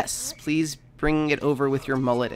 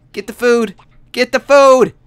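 urgent; 9 semitones higher, 14 dB louder than usual. Real, not acted. Urging starving Sim to eat.

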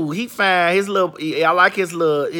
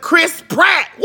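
high holler ending in a whoop; triumphant high-pitched cry; excited shout; 9 semitones higher, 4 dB louder, slower than usual. Real, not acted. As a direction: blurt the name out, thrilled. Finally remembers the actor's name.

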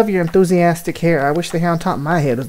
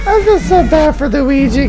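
higher and louder, gushing with sympathy; gushing over poor Luigi; 9 semitones higher, 5 dB louder than usual. Real, not acted. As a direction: coo over a cute little coward.

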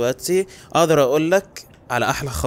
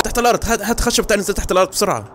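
high frantic yell to run; panicked shouting; 11 semitones higher, 7 dB louder than usual. Real, not acted. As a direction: scream at them to flee, fast.

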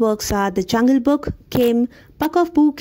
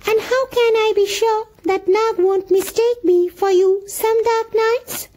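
fearful muskrat; 10 semitones higher than usual. A narrator's reading. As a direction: fret like a scared little muskrat. Muskrat keeps pleading.

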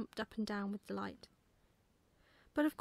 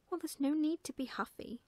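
higher, louder mild telling-off; pert, lifted reproach; indignant little girl; 6 semitones higher, 5 dB louder than usual. A narrator's reading. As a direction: chide him in a raised child's voice. Girl scolds the offended owl.

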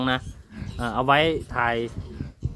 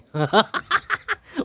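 high burst of real laughter; amused laugh; 11 semitones higher than usual. Real, not acted. Farmer laughing high at his pigs, amused laugh.

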